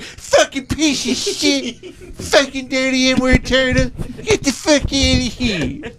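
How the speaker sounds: high-pitched, drawn-out mock screaming at a turtle; mock furious yell. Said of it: "they'd yell at him".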